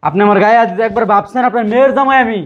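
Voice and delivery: outraged that the father-in-law hit him; son-in-law protesting indignantly to his father-in-law. Acted.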